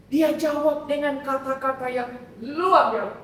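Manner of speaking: ordinary talk, nothing marked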